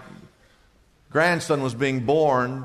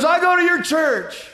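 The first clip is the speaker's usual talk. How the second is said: high, bright-eyed mock voice of the lady, much higher; imitating the lady; 11 semitones higher, 3 dB louder than usual. Pastor imitating a lady in the park, high and eager. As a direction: chirp it out like an eager churchgoer. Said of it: "She goes".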